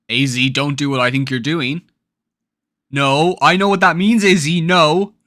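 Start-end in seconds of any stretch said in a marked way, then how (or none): 2.9-5.2 loud, insistent pleading with raised pitch; alarmed protest. Real, not acted. Player begs A Z not to do it, loud and alarmed. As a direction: protest loudly as if to stop them.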